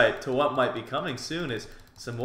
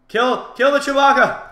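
high, loud attack yell; battle cry; 11 semitones higher, 10 dB louder than usual. Acted. Player attacking an opponent, yelled battle cry. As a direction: yell it as you charge in.

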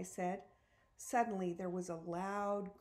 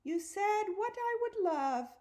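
mocking, very high and louder; squeaky-high teasing mimicry; high-pitched imitation; 13 semitones higher, 6 dB louder than usual. A narrator's reading. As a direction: mimic someone's high voice, pushed right up. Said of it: "imitating my high-pitched voice".

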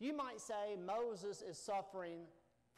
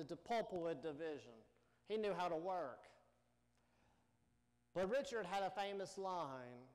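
ordinary talk

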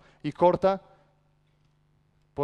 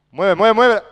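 loud, higher-pitched coaching command; urgent shouting; 4 semitones higher, 9 dB louder than usual. Real, not acted. Coach pushing players to move in the drill, urgent shout. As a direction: drive the players on at full voice.

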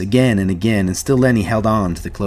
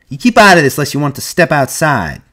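higher, louder warning; raised-voice challenge; angry threat; 6 semitones higher, 5 dB louder than usual. A narrator's reading. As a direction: warn him off, ready to fight. Curly threatens Carlson.

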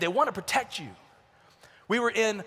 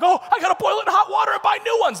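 high panicked shout, way up in pitch; anxious first-time parent; 13 semitones higher, 9 dB louder than usual. Retold or imitated. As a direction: freak out like a nervous new parent. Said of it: "You're like".

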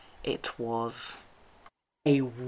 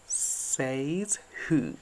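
sneered. A narrator's reading. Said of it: "sneered the wolf".

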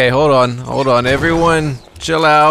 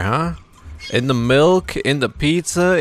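ordinary talk, nothing marked

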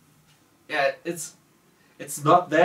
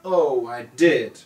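normal talking voice

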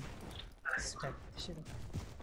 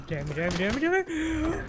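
rising panic; 9 semitones higher than usual. Real, not acted. Player cursing as his character dies, rising panic. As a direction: pitch climbing through rapid repeats. About to die in game.

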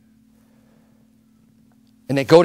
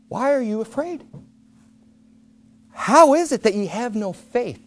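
gentle rebuke; 5 semitones higher, 4 dB quieter than usual. Retold or imitated. Jesus gently rebukes disciples.

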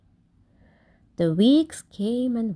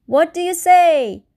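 high, louder chanted question; crowd call; 7 semitones higher, 8 dB louder than usual. Acted. The whole crowd asking Kevin together, high and louder. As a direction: everyone calling up together. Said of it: "the crowd all joined in".

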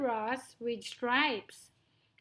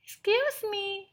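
high, timid little mouse voice; frightened mouse; 10 semitones higher, 4 dB louder than usual. Acted. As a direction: plead politely, pitched high. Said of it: "Midgie was frightened".

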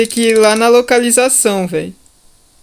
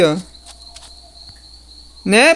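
usual speaking voice

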